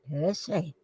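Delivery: normal talking voice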